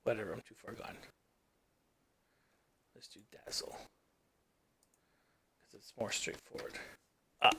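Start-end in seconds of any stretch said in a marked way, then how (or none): none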